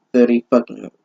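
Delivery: ordinary delivery, nothing marked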